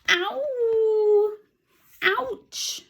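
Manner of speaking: very high little yelps of pain; squeaky mouse yelps. Acted. Teacher voicing the trodden-on mouse with high squeaky yelps. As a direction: squeak out tiny cries of hurt.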